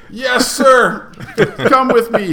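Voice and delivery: high, loud mock-eager reply; hammed-up compliance. Mark takes the lieutenant out, loudly mock obedient. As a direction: play the obedient airman loudly. Mark answering the sergeant's order.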